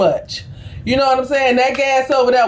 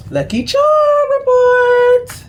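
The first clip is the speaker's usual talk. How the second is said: 1 announcer; 12 semitones higher, slower than usual. Acted. Announces tarot reading segment.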